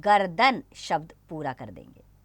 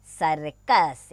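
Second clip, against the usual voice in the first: slow blended word; 4 semitones lower, much slower than usual. Shown as a demonstration. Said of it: "क्या बना".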